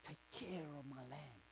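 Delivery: soft, calm instruction; Jesus commanding gently. Acted. Jesus charging Peter by the shore, soft and steady. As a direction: give the charge quietly and kindly. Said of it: "And Jesus goes".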